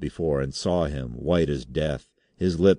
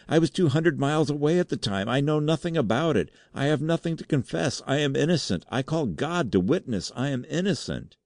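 high, wailing plea of innocence; panicked, pleading outpouring; terrified cry; 11 semitones higher than usual. A narrator's reading. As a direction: protest desperately, pitched far up. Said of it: "he cried".

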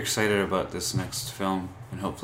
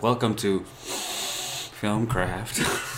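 very slow, drawn-out creepy welcome; serial killer; 2 semitones higher, much slower than usual. Retold or imitated. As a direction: stretch each word like a slasher killer. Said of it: "That sounds like you're a serial, serial killer".

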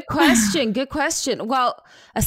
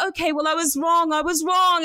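mock shouted admission, raised pitch; grudging admission; 6 semitones higher than usual. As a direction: blurt out being wrong, half-hearted shout. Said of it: "he was like".

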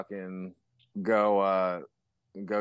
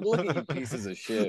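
jeering through laughter; mock gloating; 3 semitones higher than usual. Sober musician sneering at drinking bandmates as a joke, laughing mock contempt. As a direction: laugh as you pretend to look down on them.

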